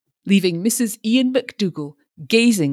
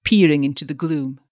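usual speaking voice

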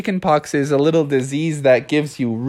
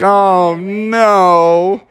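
loud, higher, drawn-out wail; dismayed wail; 6 semitones higher, 5 dB louder than usual. Acted. Frog tossed into the busy river, long dismayed wail.

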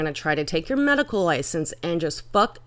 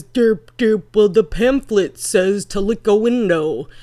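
mocking dumb pharmacist; 6 dB louder than usual. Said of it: "all the pharmacist can do is".

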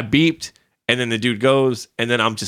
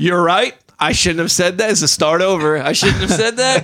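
mock sheepish apology; 5 semitones higher than usual. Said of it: "You're like".

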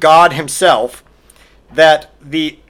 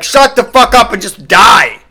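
loud, raised-pitch furious shout; angry outburst; 5 semitones higher, 6 dB louder than usual. Acted. Comedian snapping back at dying Grover, angry shout. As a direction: yell it in exasperated rage.

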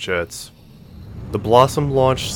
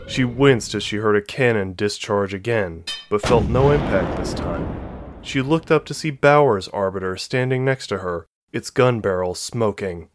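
plain reading voice

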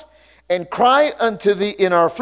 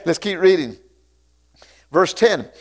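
ordinary talk

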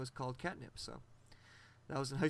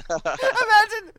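laughing; 13 dB louder, much slower than usual. Real, not acted. Laughing at the imagined greeting.

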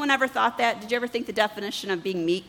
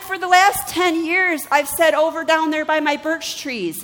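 indignant disbelief; 7 semitones higher, 5 dB louder than usual. Retold or imitated. Wife protesting to her husband about the trees, high loud disbelief. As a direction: protest ten years of being wrong.

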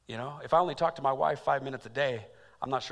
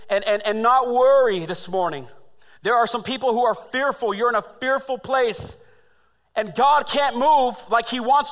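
raised, high, hammering sermon voice; preaching at full force; 10 semitones higher, 11 dB louder than usual. Acted. Pastor urging a fearful congregation, loud preaching. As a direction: preach it hard to the room.

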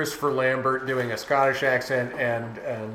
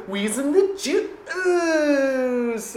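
imitating Encino Man; 11 semitones higher, much slower than usual.

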